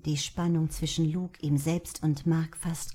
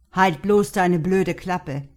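hissed; 9 dB louder, slower than usual. Acted. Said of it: "zischte Mark".

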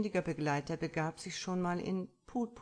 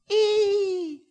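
put-on high, loud little-girl shriek of fright; child's shriek; 14 dB louder than usual. A narrator's reading. Girl frightened by sea monster.

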